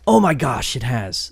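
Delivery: a sharp gasp, then a loud delighted oh my gosh; gasping surprise. Real, not acted. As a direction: caught off guard by good news, gasp it out. New drop list just appeared.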